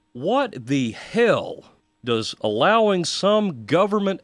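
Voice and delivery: drawn-out, exasperated "what the hell"; each word stretched out in outrage; indignant. Real, not acted. Radio host demanding answers about airport searches, indignant and slow.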